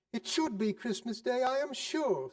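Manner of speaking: high, sharp and sarcastic; indignant wife. Acted. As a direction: scorn the miser to her husband. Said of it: "said she".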